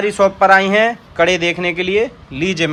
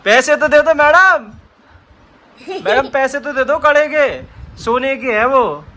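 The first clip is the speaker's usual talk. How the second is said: mock shopkeeper plea; 10 semitones higher than usual. Asking the child to pay.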